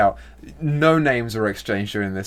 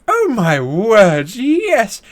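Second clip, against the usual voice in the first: much higher, louder shrill-toned gossip of high society; outraged society lady; 12 semitones higher, 8 dB louder than usual. Acted. Rich lady to Marjorie, much higher outraged gossip. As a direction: clutch your pearls and squeal it out.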